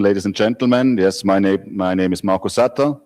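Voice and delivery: ordinary talk, nothing marked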